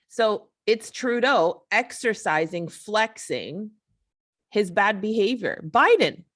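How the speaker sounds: usual speaking voice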